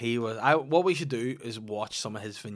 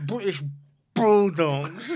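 loud laughing put-on celebrity voice; Katie Price voice; 5 semitones higher, 8 dB louder, much slower than usual. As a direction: ham it up as a giggly celebrity. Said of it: "as Katie Price".

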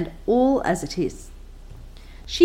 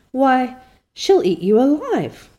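slow, higher and louder alarm; aghast; 5 semitones higher, 5 dB louder than usual. Acted. Diana warning Anne, aghast, slower and louder. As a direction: warn her in horror, drawn out.

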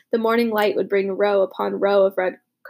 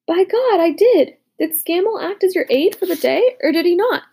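indignant; 7 semitones higher, 4 dB louder than usual. A narrator's reading. General insists in argument.

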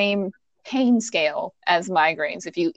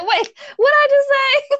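much higher, louder mock-baffled replay; mocking confused; 5 dB louder than usual. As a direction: parrot her slip, amused and exaggerated. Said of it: "You were like".

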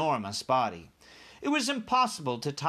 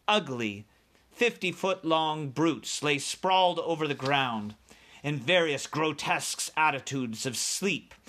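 usual speaking voice

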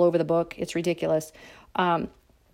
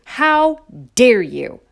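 mocking Greta Thunberg; 3 semitones higher, 6 dB louder, much slower than usual. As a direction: imitate an outraged young activist, drawn out. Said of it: "To quote that Greta Thunberg".

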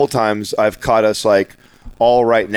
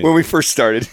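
laughing; 10 semitones higher than usual. Real, not acted. Laughing recollection.

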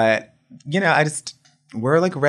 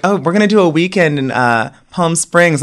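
posh friends' invite; 6 semitones higher, 7 dB louder than usual. Retold or imitated. Imitating rich friends, loud.

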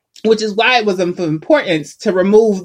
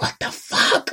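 breathy disbelieving whisper; shocked whisper; 5 semitones higher, 4 dB quieter, slower than usual. Retold or imitated. Kid shocked chicken won't drop.

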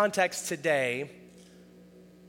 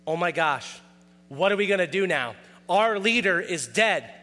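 normal talking voice

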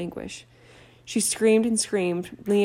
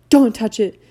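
much louder sharp warning; 3 semitones higher, 10 dB louder than usual. Acted. Harry to Ron, a sharp loud warning. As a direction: stop him with a shout.

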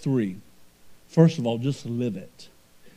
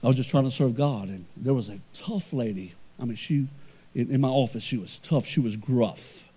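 ordinary talk, nothing marked

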